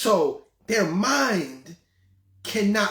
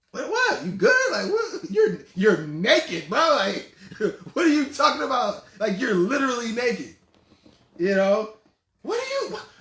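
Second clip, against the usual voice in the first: disbelieving laugh; 4 semitones higher, 3 dB louder than usual. Retold or imitated.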